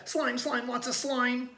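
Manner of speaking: high mock yell. A narrator's reading. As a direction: yell it, grossed out. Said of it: "yelled Tate".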